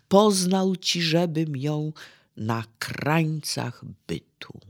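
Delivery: uroczysta deklamacja, miejscami szeptem; recytacja wiersza. Acted. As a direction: deklamuj Norwida z przejęciem, część szeptem. Recites Norwid's poem in lecture. (wykładowca recytuje studentom fragment wiersza, uroczyście)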